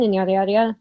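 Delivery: usual speaking voice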